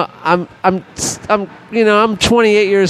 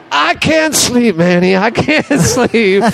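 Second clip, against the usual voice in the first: raised, slow mock whine; whiny Bob; 6 semitones higher, slower than usual. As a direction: drag it out like a sleepless rocker complaining. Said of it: "Bob comes back and goes".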